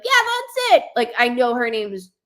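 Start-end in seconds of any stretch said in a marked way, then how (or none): 0.0-0.9 mock other medium. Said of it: "another medium would be like".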